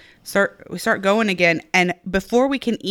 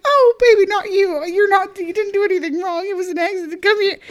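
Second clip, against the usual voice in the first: high-pitched, pleading coo; reenacted tearful mommy reassurance; soothing mother; 13 semitones higher than usual. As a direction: comfort a crying little one, voice way up high. Mother consoling her crying child, retold.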